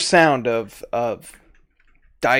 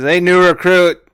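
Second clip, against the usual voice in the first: loud, raised-pitch challenge; gambling leader; 4 semitones higher, 7 dB louder than usual. Acted. Dice leader to new recruit, loud challenge. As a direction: call the rookie out briskly.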